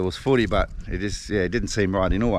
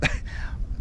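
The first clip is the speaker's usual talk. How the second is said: a short, soft laugh of embarrassment; embarrassed breathy laugh; 5 dB quieter than usual. Real, not acted. Vlogger apologising to Sean, short sheepish laugh.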